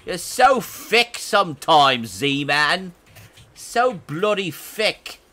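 hammed-up chav British accent, slow; mock cockney jab at a friend. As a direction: drag it out in a cheap London accent. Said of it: "What type of accent was that supposed to be".

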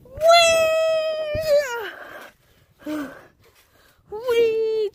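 high loud whee while bouncing; gleeful whee. Real, not acted. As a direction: squeal with delight. Bouncing on trampoline.

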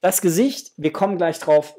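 ordinary delivery

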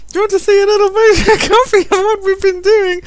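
put-on loud, very high falsetto child; mock child showing off to a parent; little girl voice. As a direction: squeal it as an eager daughter.

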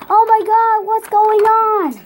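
panicked cry. Acted. Lego figure sees the giant hand, crying out in panic. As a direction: cry out in alarm as the ground shakes.

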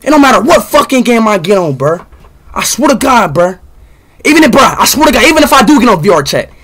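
furious streamer rant, fast at the end; loud angry rant. Real, not acted. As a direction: vent at full volume. Ranting about the game.

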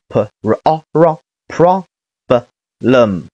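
"p r o r problem" slowly sounded out piece by piece; sounds joined up. Shown as a demonstration. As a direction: build "problem" from separate sounds. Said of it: "连起来是".

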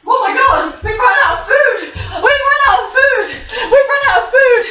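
squealing fake alarm to the dog; laughing mock panic. Real, not acted. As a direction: pretend to panic while laughing. Mock panic about food.

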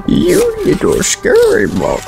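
high, slow squeak at a bug; squeaky high voice. Real, not acted. Addressing a creature in the game.

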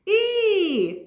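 high squeaky little mouse; a teacher's high mouse puppet squeak. Acted. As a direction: chirp it like a tiny mouse.

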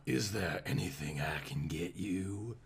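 whispered Southern nurse offer; breathy Southern whisper. Acted. Nurse offers help to Bruce in a hushed Southern whisper. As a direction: lean in and whisper it Southern-sweet. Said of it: "Nurse Eastman asked".

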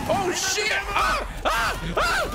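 high yelps climbing in alarm; real game panic; panicked screaming. Real, not acted. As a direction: scream as if being overrun. Overrun by zombies.

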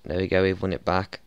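usual speaking voice

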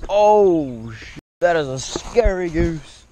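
sudden high, loud startled cry that slows into shaken gasps; startled yelp. Real, not acted. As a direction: jump out of your skin at the goose.

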